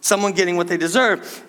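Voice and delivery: ordinary delivery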